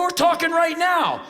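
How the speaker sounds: high, loud retort; retold argumentative shout. Acted shouting match at door.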